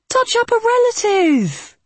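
brash advice in a high voice; pier guy voice. A narrator's reading. Pier guy's advice quoted.

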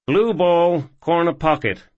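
high, bright call of the shot; eager young cowboy. Acted. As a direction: announce the shot, cocky and loud. Ethan calls his pool shot.

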